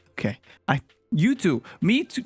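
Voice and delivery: normal talking voice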